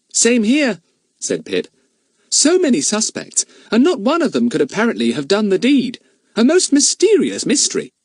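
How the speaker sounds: put-on high boyish voice bubbling over with glee; Pip, gleeful boy. Pip to Fatty, high and gleeful. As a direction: relish the memory of the prank.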